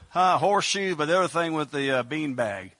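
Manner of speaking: usual speaking voice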